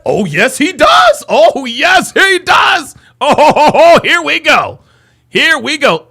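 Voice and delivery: loud, high whooping excitement; shouted glee breaking into laughter; gleeful hype. Real, not acted. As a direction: crow with delight as the fight arrives. Delighted that challenger turns up.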